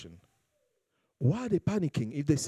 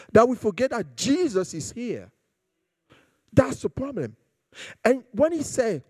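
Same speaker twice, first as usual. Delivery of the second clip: high, louder preaching at full force; 7 semitones higher, 3 dB louder than usual. Acted. Pastor urging the congregation not to panic, fervent preaching. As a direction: drive the point home to the church.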